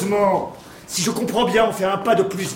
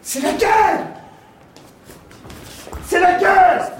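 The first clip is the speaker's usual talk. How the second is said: panicked; 11 semitones higher, slower than usual. Acted. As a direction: cry out in sheer panic. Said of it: "Allons, reprends-toi".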